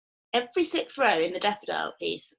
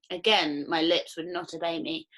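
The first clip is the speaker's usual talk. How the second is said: ordinary delivery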